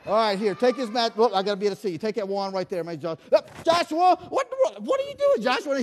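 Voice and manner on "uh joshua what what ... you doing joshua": high, loud mock scolding; hammed-up disbelief at the kid; mock exasperation. Scolding prank volunteer.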